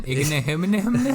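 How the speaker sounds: "Igbinoghene" as high, slow na-hum-na-hum gibberish; mocking mispronunciation. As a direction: tease by mumbling "Igbinoghene" into rising nonsense syllables. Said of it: "make fun of his name".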